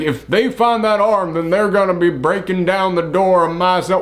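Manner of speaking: Southern drawl. Retold or imitated. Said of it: "the way that Adam Driver talks".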